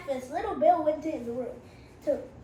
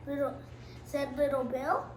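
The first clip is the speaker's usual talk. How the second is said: plain reading voice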